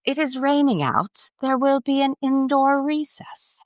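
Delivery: high, prim British announcer voice; secretary's British announcement; British accent. Retold or imitated. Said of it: "and she's British".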